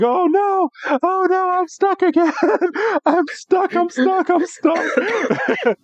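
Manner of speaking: very high, laughing creature whine; high giggling monster voice crying for help; squeaky stuck Wailord. Acted. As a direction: squeal it out like a trapped whale cartoon.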